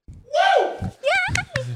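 high excited woo and yay; cheering. Real, not acted. Cheering for engineer.

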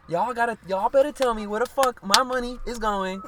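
put-on [name]'s Dominican New York accent, high and loud; a loud, high [name] accent rant; imitating [name]. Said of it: "the way in her accent and shit".

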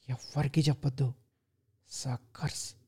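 hushed, breathless whisper; softly. A narrator's reading. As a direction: whisper it to himself in the mirror like a sly secret. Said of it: "మెల్లగా అన్నాడు".

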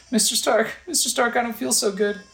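high, weak-hero movie quote; dying child. Acted. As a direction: plead in a high boyish voice. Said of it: "and he says".

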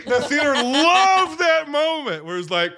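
ordinary talk

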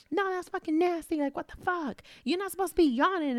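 high-pitched retold indignation; mock a friend's disgusted rant; flipping out. Friend rants at family about yawning, flipping out. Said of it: "she used to flip shit".

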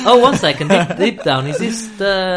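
giggling while talking; breaking into laughter; laughing. Real, not acted. Host cracks up over title.

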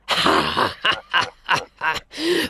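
low scornful laugh; lion laugh. Acted. Lion mocking the boy's warning, low laugh. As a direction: laugh him off like a big cat. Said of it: "The lion stopped and laughed".

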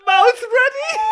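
high, loud laughing yell; shouted slogan. Pretend. Yelling tagline.

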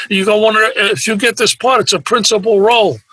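a lightly voiced agent talking him into it; pushy agent.

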